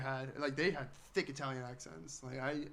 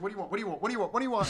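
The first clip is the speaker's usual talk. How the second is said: mock badgering; 3 semitones higher, 4 dB louder, much faster than usual. Waitress pressing for an order, mock badgering build. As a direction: build the pressure with each repeat.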